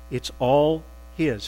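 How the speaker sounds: normal talking voice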